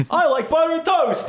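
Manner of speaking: high, slow, goofy cartoon voice. Game master joking about the revived character's speech, goofy impression. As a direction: stretch it out high and silly, half laughing. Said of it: "He just talks like Ed".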